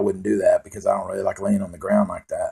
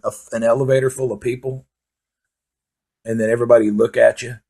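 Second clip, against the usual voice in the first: ordinary talk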